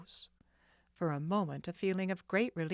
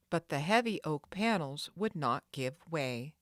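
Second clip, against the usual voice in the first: usual speaking voice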